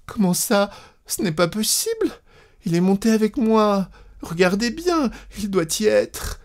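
high, alarmed woman's voice; terrified wife. A narrator's reading. Wife insisting to the conductor her husband is aboard, alarmed. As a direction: plead in a high, panicky voice. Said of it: "Elle eut un geste de terreur".

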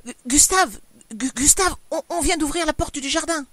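high, breathless, frightened stutter; terrified wife, loud and gasping; panting stammer. A narrator's reading. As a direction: stutter out the alarm in panic. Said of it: "haletante, elle bégayait".

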